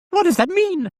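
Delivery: very high, loud puppet voice; demanding doll. A narrator's reading. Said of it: "the doll demanded".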